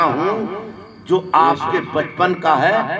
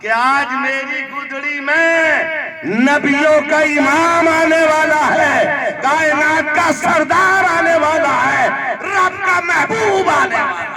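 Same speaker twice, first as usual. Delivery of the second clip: building to a high, ringing cry; full-force preaching; 8 semitones higher, 4 dB louder than usual. Acted. Preacher proclaims the Prophet's coming to Halima, full-force preaching. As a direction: climb phrase by phrase to a shouted climax.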